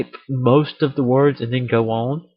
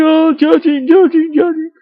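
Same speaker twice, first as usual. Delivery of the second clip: much higher, louder whiny mimic; shrill mock whine; mocking internet people; 13 semitones higher, 7 dB louder than usual. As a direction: sneer like an internet scold. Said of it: "people on the internet start being like".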